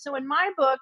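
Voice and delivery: usual speaking voice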